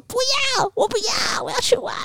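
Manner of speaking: imitating a furious toddler refusing; angry child. Mother imitates a kid who cannot go to the park, angry high shout. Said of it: "全天下所有的小孩一定都会愤怒".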